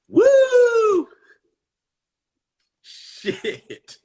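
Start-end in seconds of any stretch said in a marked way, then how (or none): 0.1-1.1 very high, loud, long whoop. Real, not acted. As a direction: let out a piercing amazed whoop. Reacting to an ugly filter face.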